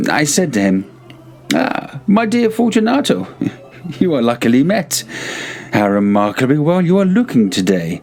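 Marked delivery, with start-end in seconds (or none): 1.5-8.0 a hint of a higher, mock-cordial host; lightly voiced Montresor. Montresor baiting his friend with the wine, lightly voiced. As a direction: lay on the false friendliness, gently. Montresor greets Fortunato at carnival.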